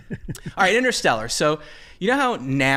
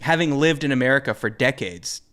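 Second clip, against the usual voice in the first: ordinary delivery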